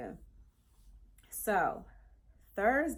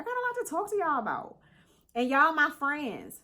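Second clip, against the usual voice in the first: playful high voice; 9 semitones higher, 8 dB louder than usual. Acted.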